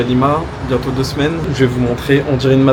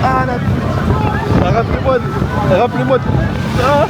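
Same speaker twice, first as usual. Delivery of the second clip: exasperated; 10 semitones higher than usual. Real, not acted. Exasperated after bad tour.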